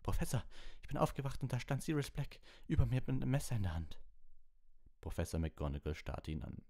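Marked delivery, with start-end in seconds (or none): 0.0-4.0 high-pitched, pleading report; urgent boy. A narrator's reading. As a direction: insist to the professor, pitched high. Ron insists on what he saw.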